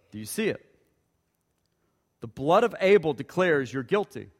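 usual speaking voice

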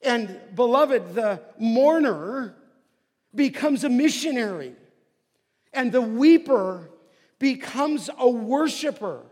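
loud, rising preacher's cry; preaching at full force. Acted. Preacher exhorts the beloved congregation, loud and fervent. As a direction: proclaim it to the congregation.

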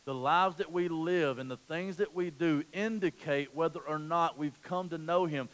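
normal talking voice

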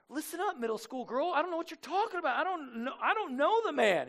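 high-pitched, flustered mock protest; acted nerves before a schoolgirl; nervous denial. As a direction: deny it nervously, voice jumping up. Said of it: "He's, like, nervous in front of this middle schooler".

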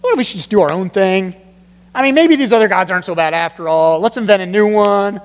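ordinary talk

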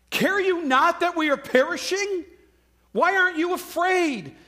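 high, urgent, incredulous plea; acted alarmed cry. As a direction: cry out as a disciple shocked at such calm. Frightened disciples' accusing cry acted out.

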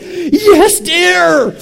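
high, loud hammed-up shout; worked-up yell. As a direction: yell it with exaggerated, total conviction. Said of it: "I was like".

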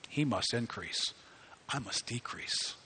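soft, slowing humble words; John calmly telling disciples, soft and humble; quiet humble quote. Retold or imitated. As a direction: let it fall away humbly.

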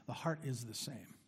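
dropped to a quiet echo; soft echo. Real, not acted. Preacher repeats the line to the congregation, soft echo. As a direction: let it fall away softly.

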